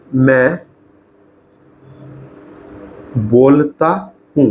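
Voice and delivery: word by word, long gaps between; slow dictation. Shown as a demonstration. Teacher starting a new example line, slow dictation. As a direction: speak as slowly as you write. Saying words while writing them out.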